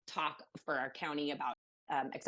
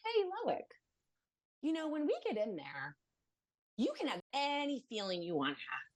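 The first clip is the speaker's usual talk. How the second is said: lightly voiced parent, higher pitch; parent coaxing child; 7 semitones higher than usual. Mother prepping her child before the meeting, gently coaxing. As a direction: coax a small child, pitched up.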